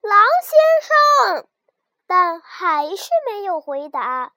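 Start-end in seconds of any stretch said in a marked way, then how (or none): 0.0-1.5 much higher, louder, slow 狼先生; the lamb calling again, louder and longer, down the cliff; loud drawn-out call. A narrator's reading. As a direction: stretch out 狼先生 as a long loud call into the distance. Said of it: "他又喊了一声".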